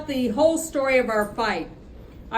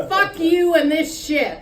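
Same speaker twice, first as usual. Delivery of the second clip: raised, angry curse; heated shout at a rival; angry outburst; 5 semitones higher, 4 dB louder than usual. Acted. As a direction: spit it at him in a fight. Acted fight between Cleve and Gilbert.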